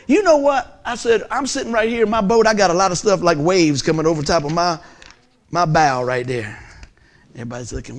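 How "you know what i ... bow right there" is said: preaching. Acted. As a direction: rouse the congregation, full voice. Preaching the boat image.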